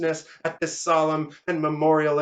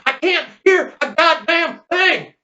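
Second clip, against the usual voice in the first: deafened hollering; 10 semitones higher, 6 dB louder than usual. Acted. Deafened Leonard to the shooter, hollered complaint. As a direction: holler as if to make them understand. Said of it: "hollering nine times as loud".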